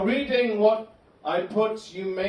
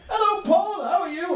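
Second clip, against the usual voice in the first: much higher, louder, sing-song cheerfulness; cheery friend Gary; 12 semitones higher, 4 dB louder than usual. Retold or imitated. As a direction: mimic an annoyingly happy Buddhist friend. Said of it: "He'd go".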